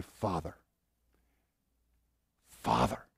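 tender prayer. Shown as a demonstration.

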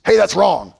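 loud, high-pitched accusing shout; hammed-up, forceful scolding; acted rebuke. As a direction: call someone out loudly. Acting out the easy harsh rebuke.

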